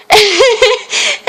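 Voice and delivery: high delighted laugh; a real amused giggle; burst of laughter. Real, not acted. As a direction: crack up remembering it. Laughing recalling the scene.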